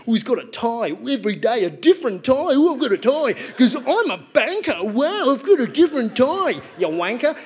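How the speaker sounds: comic mocking the tie-wearing banker dad, smug parody. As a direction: sneer as a show-off banker.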